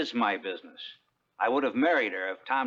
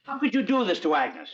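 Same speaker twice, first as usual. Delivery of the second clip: higher, indignant outburst; angry; 11 semitones higher than usual. Acted. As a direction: confront him with sharp anger. Angry friend confronts drunk Solomon.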